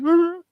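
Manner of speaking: a sudden high, loud whimper cut short; whimpered cry. Retold or imitated. Hearing his dad died, a brief high whimper. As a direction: let one sharp sob escape, then stop. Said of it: "I whimpered out a cry".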